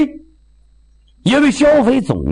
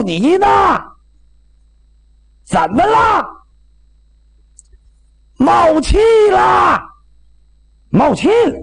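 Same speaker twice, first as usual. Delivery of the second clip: calling out; 14 semitones higher than usual. Acted. Calling out across distance.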